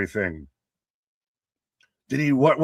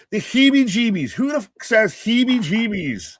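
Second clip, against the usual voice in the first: higher-pitched, scoffing disbelief; mock incredulous; 9 semitones higher, 3 dB louder than usual. Real, not acted. Co-host mocking the word heebie-jeebies to his partner, incredulous. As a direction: poke fun at a ridiculous word in a raised voice.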